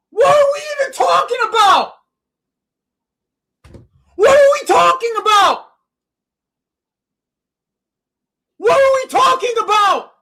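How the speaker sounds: high, loud angry yelling; furious shout. Real, not acted. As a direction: bellow the question in disbelief. Repeated raging at the game.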